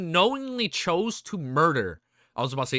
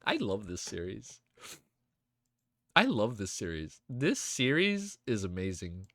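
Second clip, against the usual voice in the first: soft, low, heartfelt murmur; moved, quiet sincerity; 10 semitones lower, 9 dB quieter than usual. Real, not acted. Streamer moved by the series, quiet sincerity. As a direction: say it low and hushed, deeply moved.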